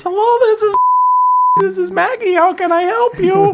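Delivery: very high, sweet receptionist voice; Aaron's falsetto phone greeting; high fake phone voice. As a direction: pitch way up like a chirpy girl answering the phone. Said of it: "Aaron picks up the phone and goes".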